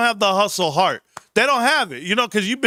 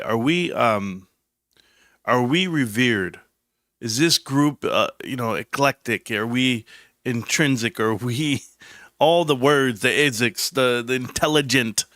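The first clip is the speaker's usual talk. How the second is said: lower, slow, put-on highbrow pondering; mock proper; 6 semitones lower, slower than usual. To the chat, opening the show in a lightly mocked proper voice. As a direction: play the refined intellectual, lightly. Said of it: "I just wanna start out this show very proper".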